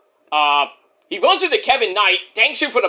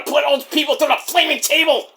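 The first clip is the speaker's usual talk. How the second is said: high-pitched ranting character voice; worked-up Mike; 7 semitones higher than usual. Acted. Mike threatening before he's told to calm down, high rant.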